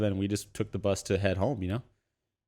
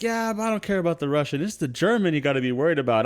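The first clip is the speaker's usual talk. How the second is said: higher, louder conspiracy talk; mock earnest street guy; 6 semitones higher, 3 dB louder than usual. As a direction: play it dead serious about the German. Second homeless man answers.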